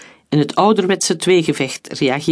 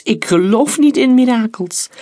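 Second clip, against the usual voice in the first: high, contemptuous retort; scornful; 7 semitones higher, 4 dB louder than usual. A narrator's reading. Soldier dismissing talk of miracles, scornful. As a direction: scoff with disdain, pitched up. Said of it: "Minachtend snoof Heinz".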